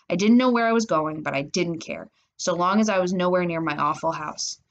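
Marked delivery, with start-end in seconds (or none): none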